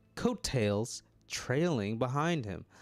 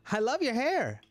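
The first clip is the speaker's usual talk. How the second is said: [name]'s voice; 12 semitones higher, 4 dB louder than usual. Acted. [name] compliments the cashier.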